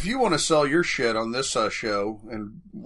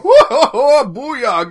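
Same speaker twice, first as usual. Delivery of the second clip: mock hype shout; 12 semitones higher, 12 dB louder than usual. Mimicking an angry listener.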